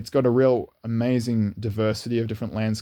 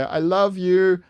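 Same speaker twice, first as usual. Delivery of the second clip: high, slow and drawn out, calling sweetly; sweet I love you called from a passing car; 9 semitones higher, 4 dB louder, much slower than usual. Retold or imitated. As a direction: stretch it high and slow like a stranger calling out fondly. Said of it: "lean out the car window and say".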